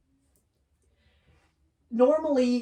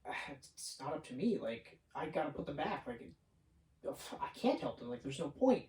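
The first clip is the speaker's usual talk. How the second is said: hardline Peter; 6 semitones lower, 11 dB quieter than usual. Retold or imitated. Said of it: "Peter is doing that hardline thing".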